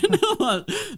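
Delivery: shrieking laugh, very high; high squealing laugh. Real, not acted. Laughing at the drunk story.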